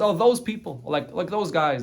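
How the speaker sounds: high, sneering "those people"; dismissive scoff. Retold or imitated. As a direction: brush the rabbis off with a scoff. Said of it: "he refers to the Chachamim and he says".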